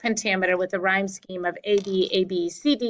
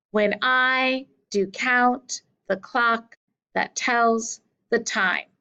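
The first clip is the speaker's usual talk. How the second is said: slow, metronomic line stressing "I", "count", "clock", "tells", "time"; exaggerated iambic rhythm; 2 semitones higher, slower than usual. Shown as a demonstration. Teacher showing students where the accent goes, exaggerated iambic rhythm. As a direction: beat out "When I do count the clock" in slow two-syllable units. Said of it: "The accent goes on the second syllable".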